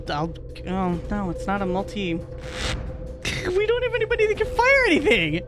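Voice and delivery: ordinary delivery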